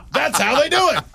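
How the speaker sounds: high, laughing punchline. Real, not acted. Host laughing at co-host's notes joke, laughing.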